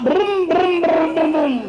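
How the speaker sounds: loud, high lip-buzzed car engine; imitated engine revving. Patient in bed driving an imaginary Maserati, revving engine noises. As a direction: rev a pretend car with the mouth.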